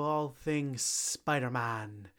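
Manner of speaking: ordinary talk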